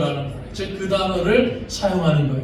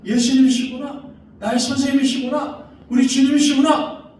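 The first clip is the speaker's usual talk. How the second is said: higher and higher, rising joyful wonder; Mary's joyful realization; 6 semitones higher than usual. Acted. Mary realizes it is Jesus, rising joyful wonder. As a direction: let the joy climb with each phrase. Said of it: "마리아가 이렇게 예수님을 부르고".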